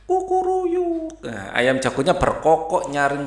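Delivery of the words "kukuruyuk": high, drawn-out rooster crow. Pretend. Teacher imitates the rooster waking Benny, high and drawn out. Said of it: "dengan suara ayam jago".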